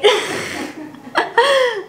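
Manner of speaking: high, slow, laughed-through repeat; laughing echo. Real, not acted. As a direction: giggle the words back to mom. Echoes mom, laughing.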